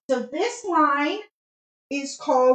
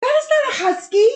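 high, loud protest; indignant exclamation; 13 semitones higher, 5 dB louder than usual. Real, not acted. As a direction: object in disbelief. Contradicting partner.

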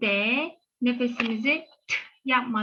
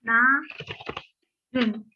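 Narın gibi drawn out very slowly while writing; very slow spelling-out. Shown as a demonstration. Teacher writes the reading on the board, very slowly. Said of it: "okunuşunu yazayım".

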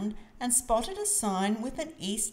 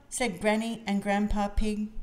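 ordinary delivery, nothing marked

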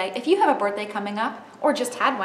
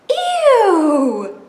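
disgusted, loud and high; a startled, grossed-out yelp; surprised ew; 11 semitones higher, 13 dB louder than usual. Acted. Reaction in story.

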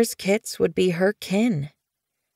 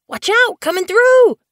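very high, louder kit yowl; yowled warning; 7 dB louder than usual. Acted. Tiger Kit yowling as he runs under the medicine cat. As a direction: yell it as the kit barrels through. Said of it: "Tiger Kit yowled".

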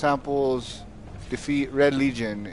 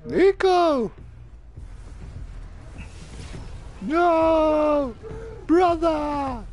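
high hammed-up wail of grief; mock anguish; 14 semitones higher, much slower than usual. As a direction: cry out like losing a brother. Mock grief at teammate downed.

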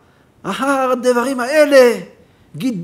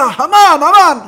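shouted name; 6 semitones higher, 9 dB louder, slower than usual. Shown as a demonstration. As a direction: call out the name loud and high. Calling out Haman's name.

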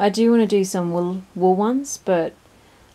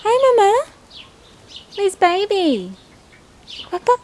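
high pet voice; 11 semitones higher, 6 dB louder than usual. Real, not acted. Asking the mother bird where her baby is, high coo.

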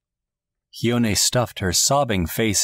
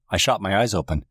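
ordinary delivery

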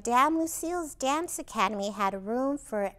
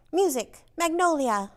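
louder, crisp command; 4 semitones higher, 6 dB louder than usual. A narrator's reading. Dance teacher ordering the piano player, loud command. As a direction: call for the music like a strict teacher. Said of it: "she commanded the piano player".

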